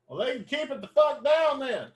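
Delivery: high-pitched indignant outburst; mock outrage. Host griping at a passing ice cream truck, mock outrage. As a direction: complain in a high, put-on huff.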